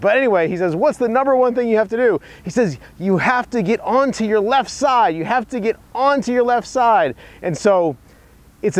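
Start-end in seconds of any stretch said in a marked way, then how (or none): 0.8-2.2 pitched-up imitation of a golf legend's accent; bad Gary Player accent. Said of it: "Sorry for my bad Gary Player accent". 3.0-7.1 higher, emphatic mock accent of a golf legend; bad Gary Player accent. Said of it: "Sorry for my bad Gary Player accent".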